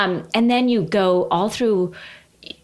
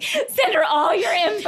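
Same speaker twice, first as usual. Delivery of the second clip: mock eager tour organizers; 10 semitones higher than usual.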